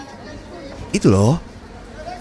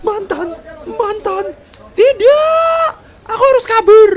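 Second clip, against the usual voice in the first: high, hammed-up panicked voice; mock him fleeing in a squeaky panic; 7 dB louder, slower than usual. Teasing her husband, squeaky mock panic. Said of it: "Ini malah kalang kabut sendiri".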